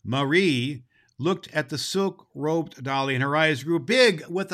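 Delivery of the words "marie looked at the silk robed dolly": usual speaking voice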